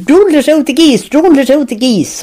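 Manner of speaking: high-pitched Tipperary Thurles call at the gate; imitating the plucker's Thurles accent, called out high; a Thurles man's high sing-song warning, imitated. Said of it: "I can remember the Thurles accent".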